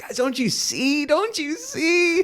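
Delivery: mocking a famous preacher begging the crowd to see; earnest plea. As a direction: plead it up high, stretching every word. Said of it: "And he's like".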